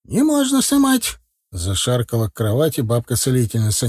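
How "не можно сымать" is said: high insistent granny voice; stubborn old healer. A narrator's reading. Бабка-целительница упрямо запрещает снимать горшок, высоко и настойчиво.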